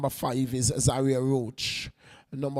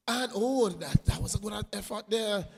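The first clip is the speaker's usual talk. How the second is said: higher "oh" that settles into praise; excited reaction; 6 semitones higher than usual. Real, not acted. Commentator praising a good effort, pitched higher. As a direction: react to the near miss with a lift.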